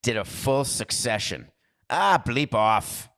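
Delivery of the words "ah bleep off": slow, loud, put-on English accent; mock English accent; attempted English accent. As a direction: drawl it like a cheeky Londoner. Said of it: "I was trying to just give you an English accent".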